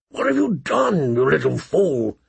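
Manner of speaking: rough, shocked rasp; hoarse. A narrator's reading. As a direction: gasp it out hoarsely. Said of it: "he asked hoarsely".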